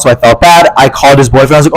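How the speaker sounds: ordinary delivery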